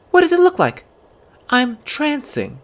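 loud, high, self-important; haughty. Acted. Girl tells boy proudly, high and loud. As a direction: toss it off importantly.

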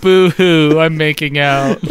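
loud mock boo-hoo sob, slow; hammed-up fake crying. Mocking sad make-out song.

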